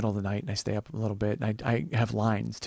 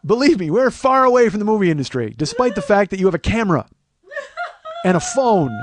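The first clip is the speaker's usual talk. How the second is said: loud, high comic protest; mock exasperated rant; 9 semitones higher, 9 dB louder than usual. Telling the crew they're far from Hollywood, loudly exasperated. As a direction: set them straight at full volume. Ranting at would-be movie people.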